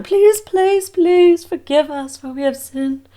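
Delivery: high, slow hammed-up begging; mock pleading royals. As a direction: beg for forgiveness like a caught royal.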